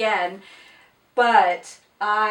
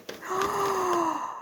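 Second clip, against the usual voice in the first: gasp; 8 semitones higher, 7 dB quieter than usual. Real, not acted. Unboxing a surprise gift.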